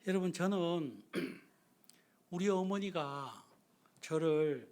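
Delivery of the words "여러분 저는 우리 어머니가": ordinary delivery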